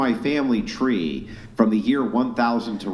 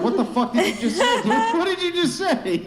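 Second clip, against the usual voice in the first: baffled disbelief. Retold or imitated. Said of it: "And you're like".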